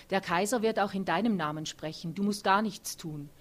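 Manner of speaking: usual speaking voice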